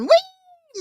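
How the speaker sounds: very high loud wee; squealing wee. Retold or imitated. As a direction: squeal it like on a slide. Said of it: "I would've done".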